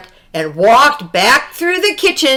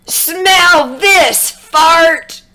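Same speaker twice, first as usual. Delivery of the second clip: defiant shout; 6 semitones higher, much slower than usual. Acted. Carmen attacking the fart.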